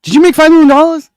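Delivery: high, loud, fast mocking reporter question; mocking pushy reporter. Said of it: "You see how they tried to do him?".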